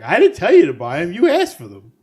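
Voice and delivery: imitating his loud, high-pitched protest; retold indignant comeback. Podcaster retells a friend's answer to co-host, loud mock indignation. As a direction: throw it back at them, loud and high. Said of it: "he gave you the best answer".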